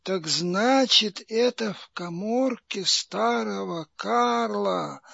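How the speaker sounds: quiet, intent and secretive; hushed voice. Acted. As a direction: lean in and murmur it.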